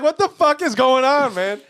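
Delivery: high, loud startled yell; shocked outburst. Retold or imitated. Telling a friend about the car leaving, a loud startled yell. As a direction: blurt it out in disbelief.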